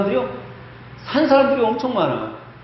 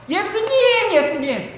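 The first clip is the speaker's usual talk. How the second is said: desperate wailing plea; 12 semitones higher than usual. Acted. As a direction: beg Jesus in tears for her daughter. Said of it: "더 심했을 것 같아요".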